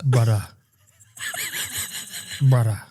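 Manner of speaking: brief mock Sudanese accent; Sudanese man. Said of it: "a guy from the Sudan".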